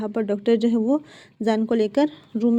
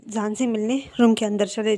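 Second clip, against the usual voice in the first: ordinary delivery, nothing marked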